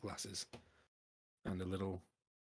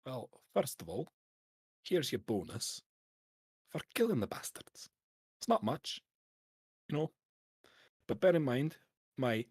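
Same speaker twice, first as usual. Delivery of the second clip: hearty Scottish dwarf paymaster; 2 semitones higher, 5 dB louder than usual. Acted. Dwarf hands over a bonus and a drink, hearty Scottish dwarf. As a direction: play a lively dwarf boss with a Scots accent. Game master voices dwarf.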